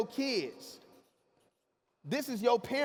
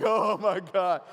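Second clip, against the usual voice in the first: slow, drawn-out dread at the open door; imitating a scared skydiver; mock terrified; 4 semitones lower, 4 dB louder, much slower than usual. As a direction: stretch it out like you're frozen in fear. Friend stuck at plane door scared.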